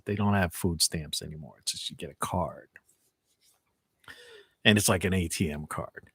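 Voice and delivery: wheezing from talking too long; wheezy voice. Real, not acted. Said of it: "I'm wheezing because I'm talking so much".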